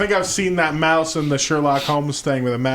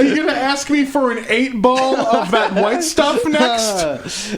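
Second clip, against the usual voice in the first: mock accusation; 7 semitones higher, slower than usual.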